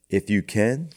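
"If you can" read with a rising, questioning end on "can"; questioning tune. A narrator's reading. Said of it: "question mark".

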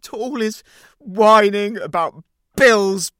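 loud and high throughout; shouting tirade. Acted. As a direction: keep yelling him down. Shouting over his complaints.

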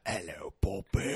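low put-on Cockney pirate voice. Impersonating a Pirates of the Caribbean character, low Cockney. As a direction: growl it like an old pirate. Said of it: "Pirates of the Caribbean, like".

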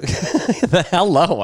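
high, drawn-out mock hello through laughter; laughing, slow parody greeting; laughing mimicry. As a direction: crack up while mimicking a bad southern accent. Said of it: "What is that?".

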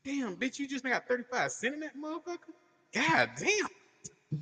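high mocking voice; mocking friend. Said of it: "about twenty minutes later".

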